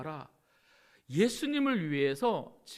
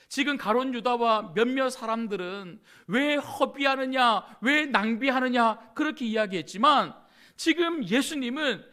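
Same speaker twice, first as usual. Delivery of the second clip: louder, higher sermon delivery; fiery preaching; 6 semitones higher, 6 dB louder than usual. Acted. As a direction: press the point on the crowd. Preaching at full force.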